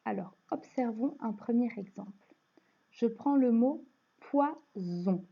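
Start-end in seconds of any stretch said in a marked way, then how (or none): 4.2-5.2 "poison" slowly in two parts, with a buzzing Z; Z sound in poison. Shown as a demonstration. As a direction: split "poison" as poi-zon, leaning on the Z. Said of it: "Je chante bien le Z".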